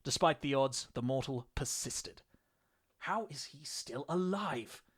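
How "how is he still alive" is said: slow, higher-pitched, hushed disbelief; drawn-out baffled question; astonished inner thought. A narrator's reading. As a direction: wonder to yourself, incredulous. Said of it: "The question echoed within the Astartes' mind".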